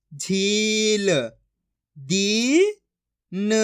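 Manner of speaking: each syllable stretched long and held; very slow syllable-by-syllable reading demo; drawn-out pronunciation. Shown as a demonstration. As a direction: draw out every vowel like a reading teacher. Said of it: "आपको खींच के पढ़ना है".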